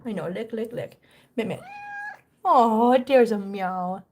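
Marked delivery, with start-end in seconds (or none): none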